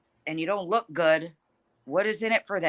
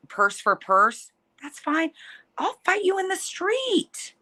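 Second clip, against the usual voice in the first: feisty; 9 semitones higher, slower than usual. Real, not acted. Challenging a rival boxer to fight.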